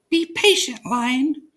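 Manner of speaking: high, squeaky little mouse; a small grateful mouse voice; relieved little mouse squeak; tiny mouse. A narrator's reading.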